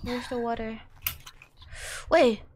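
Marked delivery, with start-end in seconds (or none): 0.0-0.8 whispering. Real, not acted. Whispering to self.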